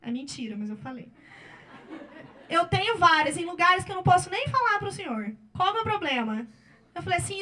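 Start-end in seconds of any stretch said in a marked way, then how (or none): 2.5-6.5 louder, laughing through the words; loud laughing retort. Retold or imitated. Lawyer repeating her retort to the attorney, laughing loudly. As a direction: throw it back with a laugh.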